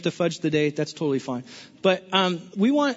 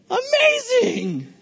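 much higher, slow, voice cracking on a cry; cracking high shout; much slower than usual. Real, not acted. Pastor to congregation, high cracking shouted amazing. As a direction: let it break into a high yelp.

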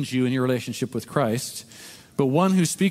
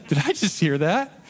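amused disbelief; 3 semitones higher than usual. Retold or imitated. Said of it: "I'm like".

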